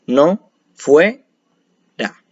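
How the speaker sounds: "Bue, no, fue, ra" in separate chunks; syllable by syllable. Shown as a demonstration. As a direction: pause after each syllable of "Bue, no, fue, ra". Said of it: "sino que serían completas".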